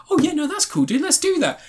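high-pitched, eager film-character reply; mock eagerness. Acting out the main character agreeing, mock eagerness. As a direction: imitate someone happily going along, voice pitched right up.

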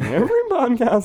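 laughing, squeaky high voice crack; mock the cracking voice, jumping high; imitating voice crack. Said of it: "as my voice cracks".

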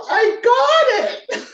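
high, loud, drawn-out wail; mock sobbing. Sobbing dancer tells her teacher, imitated tearful wail. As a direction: wail it out as if bawling, hammed-up. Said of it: "I'm sobbing and sobbing".

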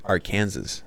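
Arkansas said as "ar-KAN-zus"; mispronounced Arkansas. Shown as a demonstration. Guest recalls his old reading of the word, Arkansas as "are-Kansas". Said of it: "I used to call it Arkansas".

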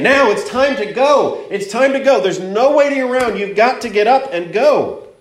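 emphatic preacher voicing urgent messengers; urgent angels. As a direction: press them to leave, pitch lifted high. Acts out angels urging Lot.

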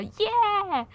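a high, bright little yay of joy; happy cheer. Real, not acted. Celebrating that the birthday gift set is finally complete, with a high joyful yay. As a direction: cheer out loud.

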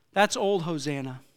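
usual speaking voice

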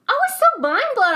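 high, loud disbelief; outraged disbelief. Real, not acted. A server tells her cohost how floored she was, high and loud. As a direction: let the outrage peak.

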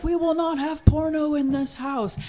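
high, stern mock dad voice; imitating an angry father. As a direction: play the outraged father.

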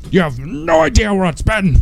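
high-pitched mock indignation; quoting a movie character. Fan repeats a favourite Grinch line, mock indignant. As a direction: imitate the Grinch's exasperated protest.

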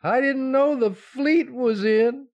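high, loud, slow and dazed. Acted. Husband reeling at triplets news, high and slow before fainting. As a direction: reel as if about to faint.

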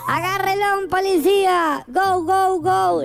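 high-pitched comic action-film command; movie cop. Acted. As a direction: play the film policeman ordering an arrest. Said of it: "Me hacía el de la película".

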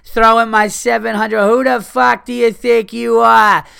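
put-on shrieking drunk Boston woman, high and loud; high, loud mock tirade; drunk girl yelling. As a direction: scream it like a furious drunk girl outside a club. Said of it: "she's just like".

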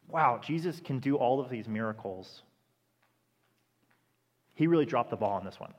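flat, let-down skeptic, normal level; doubting onlooker. Retold or imitated. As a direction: shrug it off as if he failed. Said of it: "there's two ways the logic could go".

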